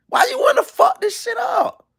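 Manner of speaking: hammed-up high cry; mock anguished shout. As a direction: yell it like a heartbroken husband. Acted husband pleading with wife.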